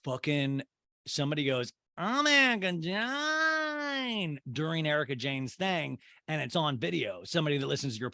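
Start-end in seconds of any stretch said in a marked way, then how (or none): 2.0-4.4 high, stretched-out yell; drawn-out heckle. Retold or imitated. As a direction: belt the name out long and high. Imitating crowd shout at event.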